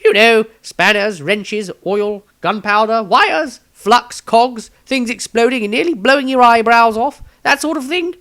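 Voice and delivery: high, loud, eccentric wizard voice; a self-important old wizard rattling off his passions, pitched high; pompous wizard. Acted. Maestro's voiced lines in story.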